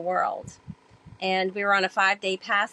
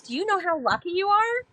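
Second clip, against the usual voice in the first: mentor's voice; 10 semitones higher than usual. Retold or imitated. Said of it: "And he goes".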